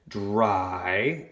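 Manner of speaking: "Dry" held out on its vowel; long I syllable. Shown as a demonstration. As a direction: stretch "Dry" as a separate syllable. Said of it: "long I".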